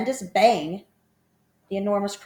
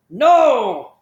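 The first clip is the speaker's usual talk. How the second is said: firm no; 5 semitones higher, 11 dB louder than usual. A narrator's reading. Trunky refuses the crocodile.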